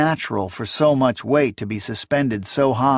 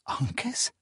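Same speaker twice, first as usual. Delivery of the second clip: breathless whispered plea; 12 semitones higher, 10 dB quieter than usual. A narrator's reading. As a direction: whisper the god's name in despair. Desperate silent plea to god.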